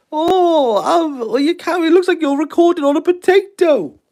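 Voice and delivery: high, snooty mock critic; mock snooty viewer. Viewer sneering at the potato camera, mock high snooty voice. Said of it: "mocking imagined viewer complaints".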